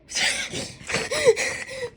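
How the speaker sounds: laughing in a high, voiceless burst; high breathy laughter. Real, not acted. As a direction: break up laughing after a slip. Laughs after the blooper.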